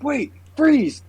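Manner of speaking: lightly acted, high-pitched order to halt; mock cop command. Viewer acting out a cop stopping a vampire, mock cop command.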